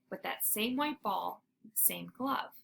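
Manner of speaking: ordinary delivery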